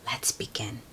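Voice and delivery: hushed whisper; soft storytime whisper. Real, not acted. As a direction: whisper it like a secret.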